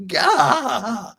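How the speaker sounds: sudden high, loud strangled "Gah!"; exasperated cry. Retold or imitated. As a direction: blurt it out like a frustrated yelp. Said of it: "my first response was".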